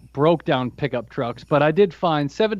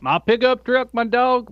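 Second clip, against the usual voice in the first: Southern cliché; 6 semitones higher than usual. Retold or imitated. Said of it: "people talk about the South".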